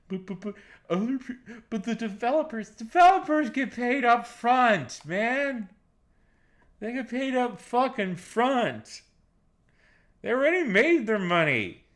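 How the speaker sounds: high, slow whining mockery; whiny fan voice. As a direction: whine like a defensive fan. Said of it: "It's like".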